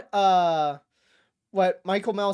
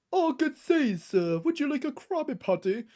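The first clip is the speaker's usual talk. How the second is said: mock British accent; 7 semitones higher than usual.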